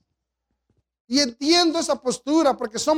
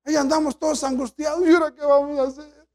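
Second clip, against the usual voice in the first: acted panic; 4 semitones higher than usual. Preacher voices believers' panic.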